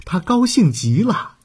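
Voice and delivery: ordinary delivery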